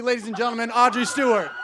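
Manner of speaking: ordinary talk, nothing marked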